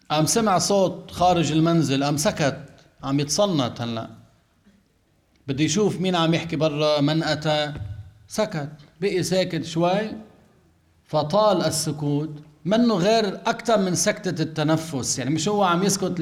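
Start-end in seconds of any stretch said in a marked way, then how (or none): none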